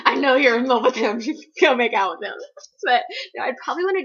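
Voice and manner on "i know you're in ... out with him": loud, laughing mock command; giggly matchmaking shout; teasing, laughing order. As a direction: push him, cracking up. Imagined bossing character about Dean.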